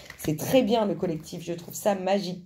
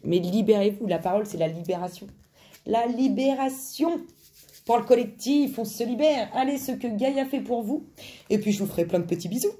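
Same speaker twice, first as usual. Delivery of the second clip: normal talking voice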